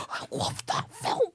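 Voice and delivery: gasped, breathy whisper of delight; slow, voiceless swoon after an excited gasp; gushing whisper. Real, not acted. Co-host gushing over a favourite film, awed whisper.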